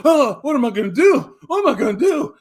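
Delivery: hammed-up boomer man panicking over money; mock panic. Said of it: "then they go".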